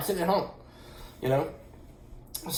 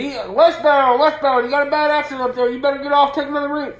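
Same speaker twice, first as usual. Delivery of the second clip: much higher and louder Southern-tinged radio call; mimicking a CB caller warning of a wreck; CB trucker voice; 9 semitones higher, 6 dB louder than usual. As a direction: hail the westbound trucks over the CB. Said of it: "somebody gets on the C B radio and says".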